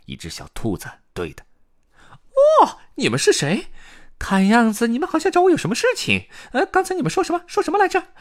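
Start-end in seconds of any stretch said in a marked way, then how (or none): 2.6-8.1 very high, rapid-fire rabbit voice; 语速飞快的野兔. A narrator's reading. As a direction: gabble the questions in a squeaky hare voice. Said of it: "野兔朗朗语速飞快". (尖细高音、飞快追问的野兔)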